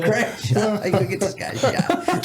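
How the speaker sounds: high voice breaking into laughter; laughing mock scolding. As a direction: retell a panicked hush through laughter. Laughing while retelling scolding Craig.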